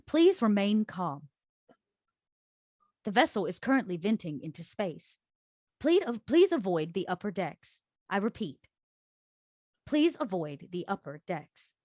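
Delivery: measured, automated announcement voice; robotic ship voice. Acted. Ship's computer warns crew over the alarm, robotic announcement voice. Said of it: "that robotic voice that says".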